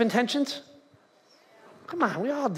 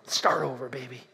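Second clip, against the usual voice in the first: put-on low, dropping to soft on baby; cool, low-voiced self-encouragement; self-assured New Year pep talk; 11 semitones lower, 6 dB quieter than usual. Voicing New Year's optimism.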